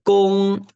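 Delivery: gong held high and level, loud; first tone. Shown as a demonstration. Teacher demonstrates first tone gong. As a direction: sing gong on one high flat pitch. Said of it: "El primero".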